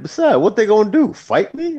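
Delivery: taunt, half joking; a hint of a cocky dare; mock tough challenge. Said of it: "I'm like".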